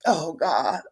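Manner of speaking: soft, broken sob of a sigh; real crying. Real, not acted. Breaks down over her pain.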